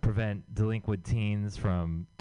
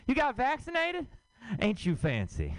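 country cousin; 11 semitones higher than usual. Retold or imitated. Cousin mocking the vaccinated comic, high teasing voice. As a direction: sneer at city ways. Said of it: "her response was".

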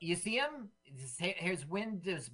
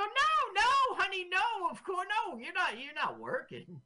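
much higher, reassuring, squeaky; high placating voice; 4 dB louder than usual. Retold or imitated. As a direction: soothe her in a high sing-song. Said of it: "And I go".

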